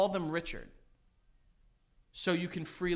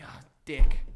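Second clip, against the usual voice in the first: mock exasperated call; 4 semitones lower, 12 dB louder than usual. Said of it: "someone like".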